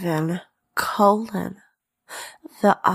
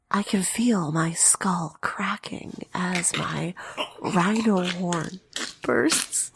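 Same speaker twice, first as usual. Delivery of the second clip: breathy close-mic whisper; ASMR whisper; 4 semitones lower, 4 dB quieter than usual. Pretend. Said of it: "sneak preview of some Animorphs A S M R".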